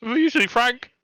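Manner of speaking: squeaky high panicked kobold voice; a terrified little thief babbling apologies; blubbering kobold. Acted. Kobold caught stealing carrots, panicked high squeak.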